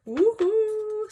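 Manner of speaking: high, loud woohoo; celebrating the thousand-dollar slip with a whoop; excited whoop. Real, not acted. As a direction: whoop with delight.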